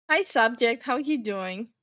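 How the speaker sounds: normal talking voice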